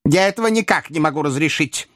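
put-on high old lady's objection; objecting old lady. A narrator's reading. Old lady refusing her coachman's offer, high objecting voice. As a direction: object firmly, pitched well up. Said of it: "возразила старая леди".

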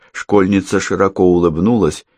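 ordinary delivery, nothing marked

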